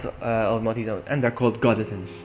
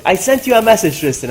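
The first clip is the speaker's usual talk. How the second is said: calling out; 6 semitones higher, 7 dB louder than usual. Real, not acted. Calling out to viewer Tristan.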